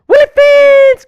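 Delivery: high, loud shout of the name; excited yelp. Real, not acted. Spotting a viewer in chat, a sudden high yell. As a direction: yell it out in glee.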